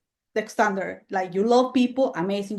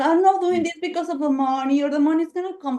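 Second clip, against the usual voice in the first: mock self-justifying voice, pitched up; mocking quote; 6 semitones higher than usual. Mocking the guy who justifies, high mock voice. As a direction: parody a people-pleaser's excuse. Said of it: "here we're".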